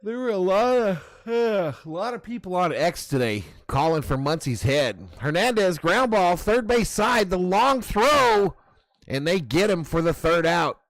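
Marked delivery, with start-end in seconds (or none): 5.2-10.8 rising, louder baseball call; excited play-by-play. Retold or imitated.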